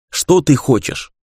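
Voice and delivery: plain reading voice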